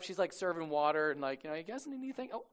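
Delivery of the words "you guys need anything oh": put-on high, soft girl's voice; lightly comic schoolgirl questioning; middle school girl. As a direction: pitch it way up as a young girl. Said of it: "middle school girl walks up".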